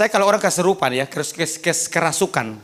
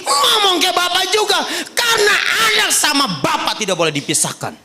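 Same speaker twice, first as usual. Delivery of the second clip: fervent preaching; 14 semitones higher than usual. Acted. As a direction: urge them with full force. Preaching at full force.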